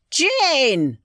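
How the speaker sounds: high, insistent call. Acted. Husband to unresponsive deaf wife, calling out. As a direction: call out to someone hard of hearing.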